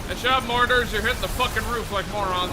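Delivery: loud, high battle yelling; excited shouting. Real, not acted. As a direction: yell orders mid-fight. Heated battle against enemy waves.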